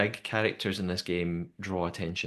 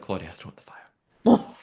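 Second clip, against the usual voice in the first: hushed instruction; 4 dB louder than usual. Pretend. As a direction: voice dropping into a near-whisper. Acting a producer instructing Claudia.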